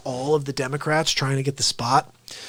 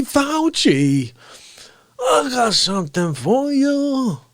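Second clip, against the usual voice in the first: high, drawn-out hammy voice; exasperated blowhard character; 11 semitones higher, 7 dB louder, much slower than usual. Acted. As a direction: build to a long, high yell.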